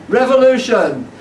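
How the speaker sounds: high, ringing protest call; rallying cry. Acted. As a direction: call it out to a crowd. Rallying cry in performed poem.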